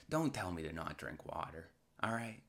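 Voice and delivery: ordinary delivery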